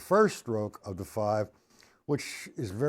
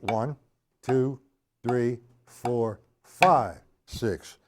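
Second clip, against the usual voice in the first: nice and slow count; much slower than usual. Shown as a demonstration. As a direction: count it slowly and stress five. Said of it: "Nice and slow".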